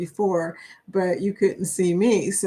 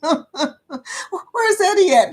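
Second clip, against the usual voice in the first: high, slow, laughing through words; laughing high question; 9 semitones higher, 3 dB louder, much slower than usual. Real, not acted. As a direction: giggle it out. Laughing while asking.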